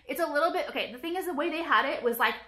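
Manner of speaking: ordinary delivery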